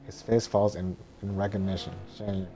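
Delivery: ordinary talk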